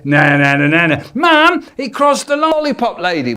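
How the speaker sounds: loud high singsong tattle; tattling sibling. Retold or imitated. Sister tattling to mum, high singsong. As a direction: tell on him to Mum.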